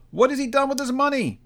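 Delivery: high, prying question; nosy gentleman voice. A narrator's reading. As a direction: ask greedily about the money. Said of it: "asked a red-faced gentleman".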